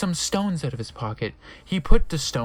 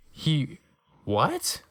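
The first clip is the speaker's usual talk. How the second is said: ordinary talk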